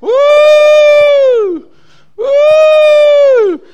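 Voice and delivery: high, loud whooping shout; old lady whooping up the altar steps; old lady's shout. Retold or imitated. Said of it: "she starts up them steps".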